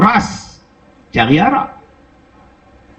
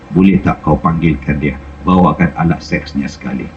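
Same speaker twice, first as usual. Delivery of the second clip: blind Kasim's voice. Retold or imitated. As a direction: speak low and gentle, asking who weeps. Blind Kasim asks who plays.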